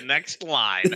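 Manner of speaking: words dissolving into laughter; laughing. Real, not acted. Repeating the friend's joke, cracking up with laughter. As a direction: lose it mid-sentence.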